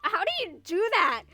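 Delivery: loud, high exasperated outburst; indignant. Real, not acted. Kid left behind at Six Flags and blamed, indignant. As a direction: raise your voice in disbelief, pitch climbing.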